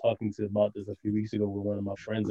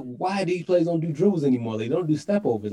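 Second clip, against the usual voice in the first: put-on heavy Nigerian accent, higher pitch; 7 semitones higher, 5 dB louder than usual. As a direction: imitate a frustrated dad's thick Nigerian accent. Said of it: "heaviest Nigerian accent ever".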